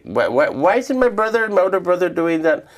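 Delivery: put-on high, loud whiny kid voice; childish complaint. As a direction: whine like a small boy who has to do chores. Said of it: "Sometimes I would complain".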